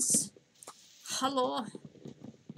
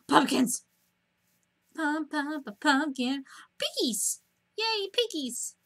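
high, bouncy delight; excited squeal; 5 semitones higher, slower than usual. Real, not acted. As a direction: squeal with glee at each find. Finding pumpkins and pigs.

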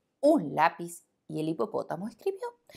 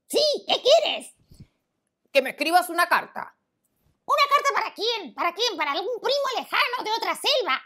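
cheeky monkey; 6 dB louder than usual. Acted. Monkey answering the lion, high squeaky monkey voice. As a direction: play a playful little monkey.

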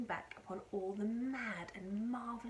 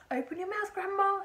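high, loud call; urgent cry; 11 semitones higher, 10 dB louder than usual. A narrator's reading. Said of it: "he cried".

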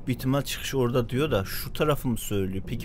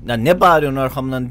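annoyed yell; 3 semitones higher, 9 dB louder than usual. Real, not acted. Snaps at the partner character.

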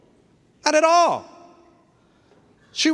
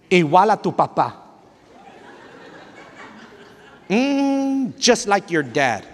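scornful mom put-down; slower than usual. Retold or imitated. Mom insulting child's father.

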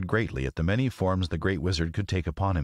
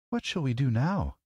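put-on high girl's voice, a touch sad; mild sadness; 7 semitones higher than usual. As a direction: ask a little sadly as a young girl. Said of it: "asked Dorothy sadly".

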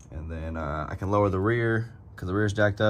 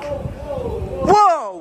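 surprised whoa; 4 dB louder than usual. Real, not acted. Sees gas tank in trunk.

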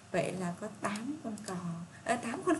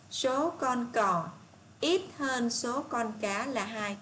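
drawn-out reading of the statement; slow read-aloud; 2 semitones higher, 4 dB louder, much slower than usual. Shown as a demonstration. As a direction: read it slowly for the class to check. Teacher reads statement B. (đọc chậm câu B cho cả lớp)

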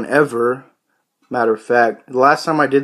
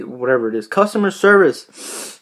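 normal talking voice

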